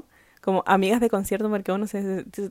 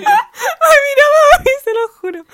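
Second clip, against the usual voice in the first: high, loud, laughing through the words; can barely get it out for laughing; 9 dB louder than usual. Real, not acted. Telling friends he looked at her, laughing hard.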